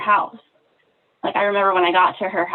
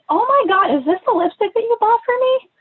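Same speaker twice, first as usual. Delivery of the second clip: high, loud squeal of surprise; a shocked delighted squeal; excited surprise; 13 semitones higher, 3 dB louder than usual. Retold or imitated. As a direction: gasp it out, thrilled and amazed. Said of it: "So I'm like".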